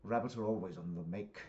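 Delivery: put-on UK accent; Victor in British accent. Visitor warning about rabbits, British accent. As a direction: voice a British neighbour passing judgement. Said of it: "I speak in the accents 'cause it's U K".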